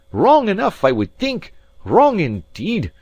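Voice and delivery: loud, high-pitched indignant outburst; fury. A narrator's reading. Alan keeps raging at the maid over her question, furious. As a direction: hammer it out in high, loud anger. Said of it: "with a kind of fury".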